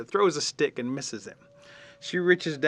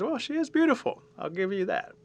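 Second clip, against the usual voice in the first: mud dauber voice; 10 semitones higher than usual. Acted. Mud dauber admiring the girl.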